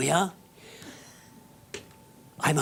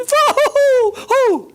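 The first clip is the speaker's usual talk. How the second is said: mocking high cackle; 11 dB louder than usual. Mocking onlookers laughing.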